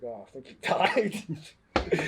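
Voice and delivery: slow, drawn-out mock worry; nervous, retold. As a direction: imitate a nervous onlooker, very slowly. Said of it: "like, nervous".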